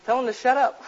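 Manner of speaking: mock the fickle critics, pitch up; mocking complaint. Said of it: "they say".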